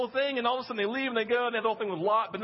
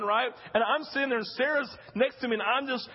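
normal talking voice

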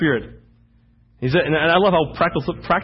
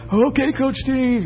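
put-on teasing girls; 9 semitones higher, slower than usual.

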